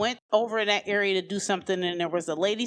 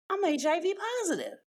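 stunned confession; 10 semitones higher than usual. Retold or imitated. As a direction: barely get it out, pitched up. Telling uncle diagnosis.

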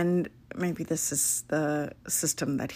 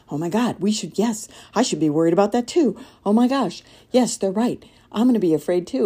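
mock panic; 5 semitones higher, 7 dB louder, faster than usual. Mocking anxious people mirroring worry.

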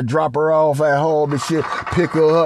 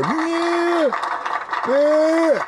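high, drawn-out mock moan; 14 semitones higher than usual. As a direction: squeak it out like a faked groan of pleasure. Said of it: "He was like".

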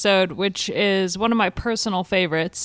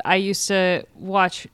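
ordinary delivery

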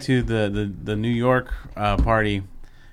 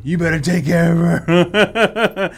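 slow, loud, higher mock threat with a laugh; stern dad warning; 7 semitones higher, 7 dB louder, slower than usual. Ric Flair warning his daughter's boyfriend, slow loud mock threat. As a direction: lay down the law like an overprotective father. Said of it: "he looks right at Andrade, like".

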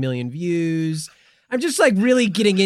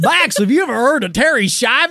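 mock Goofy lecture; 7 semitones higher, 5 dB louder than usual. Goofy bit continues.

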